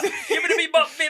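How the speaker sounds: laughing mock British talk, fast and high. Host mocking how British people talk. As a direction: rattle it off in a silly British voice. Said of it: "all British people talk".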